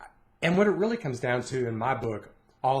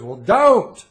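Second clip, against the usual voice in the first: sharp, loud, higher two-word comeback; blunt dad retort; 6 semitones higher, 11 dB louder than usual. Retold or imitated. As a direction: fire it back loud and curt. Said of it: "And he goes".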